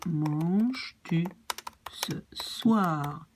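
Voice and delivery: slow "manges-tu ce soir?" with tu bound to the verb; slow French model. Shown as a demonstration. Teacher modelling the inverted question slowly. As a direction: draw the words out for learners. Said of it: "that's also correct".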